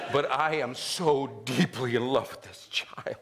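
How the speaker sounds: slow, whispered confession of love; acting a father overcome by love; awed whisper. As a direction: whisper it slowly, as in prayer. Dad's awed prayer over newborn.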